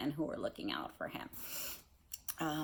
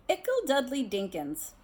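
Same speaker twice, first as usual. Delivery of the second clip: put-on higher, gushing baby talk again; doting aunt; 8 semitones higher, 5 dB louder than usual. Teacher repeats Aunt Petunia's pet name for Dudley, cooing. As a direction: coo it like a proud aunt. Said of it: "She has a lot of nicknames".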